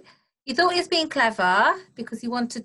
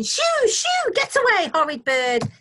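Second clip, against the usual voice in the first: high, loud shooing of a bird; high-pitched scolding shout; 7 dB louder than usual. A narrator's reading. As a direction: shout it away like an angry farmer's wife. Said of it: "and shouting".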